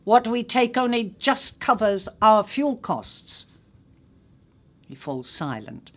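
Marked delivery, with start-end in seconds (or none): 0.0-3.5 higher, louder curt reply; retorting. A narrator's reading. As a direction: snap back, fed up. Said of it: "he retorts".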